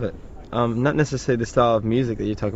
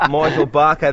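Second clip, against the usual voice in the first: imitating a broad Aussie saying his name; higher, louder Australian pronunciation, R dropped; broad Australian accent; 5 semitones higher, 6 dB louder than usual. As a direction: say it in thick, unadjusted Australian. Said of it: "'Cause every time he goes".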